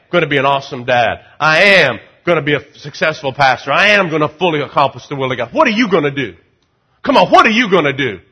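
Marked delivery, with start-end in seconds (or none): none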